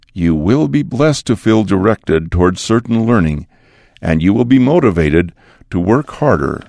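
ordinary talk

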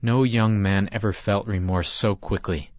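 plain reading voice

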